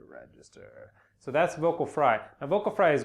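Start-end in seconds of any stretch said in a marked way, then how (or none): 0.1-0.9 much lower, soft creaky fry; buzzy fry. Shown as a demonstration. Teacher showing the lowest register, creaky fry. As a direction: let the word sink into a buzzy croak. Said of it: "that kind of buzzy kind of sound".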